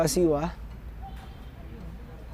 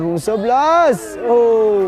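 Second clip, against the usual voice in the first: excited; 4 semitones higher, 7 dB louder, slower than usual. Real, not acted. Shot hits the goalpost.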